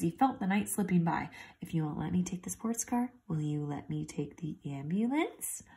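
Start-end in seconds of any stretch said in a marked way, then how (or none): 1.6-5.6 softly coaxing; quiet. Acted. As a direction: bargain in a hushed voice. Ralph bargains quietly.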